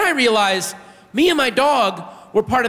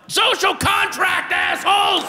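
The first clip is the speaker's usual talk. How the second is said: higher, slow, shouted declaration; triumphant yell; 12 semitones higher than usual. Retold or imitated. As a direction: bellow it holding the bag high. Shouting at the street with poo.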